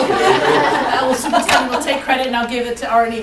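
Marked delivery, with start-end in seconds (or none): 0.1-1.0 loud laugh. Real, not acted. Laughs on taking credit.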